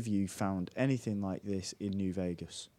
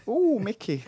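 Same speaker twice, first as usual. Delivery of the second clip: one exec to another in the lift, high-pitched coo; mock amorous exec; 11 semitones higher, 5 dB louder than usual. Joking pillow talk between bosses, high mock coo. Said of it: "and one said".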